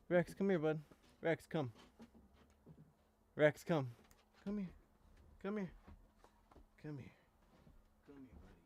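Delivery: high calls to the puppy; calling the dog. Real, not acted. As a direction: call the dog over, coaxing.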